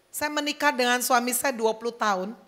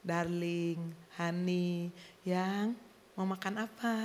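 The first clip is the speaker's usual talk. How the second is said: soft, low, slow lover's talk; hushed, low sweet nothings; romantic murmur; 6 semitones lower, 10 dB quieter, slower than usual. Acted. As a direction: coo it quietly and slowly to a sweetheart. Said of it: "ngomongnya bisik-bisik, pelan-pelan".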